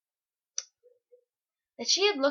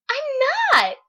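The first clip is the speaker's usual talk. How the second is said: high, loud protesting shriek; indignant yell; 10 dB louder than usual. Retold or imitated. Student protests to boy threatening to take the marker, indignant yell. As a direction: yell a shrill-pitched, defiant denial. Denies drawing a pentagram to boy.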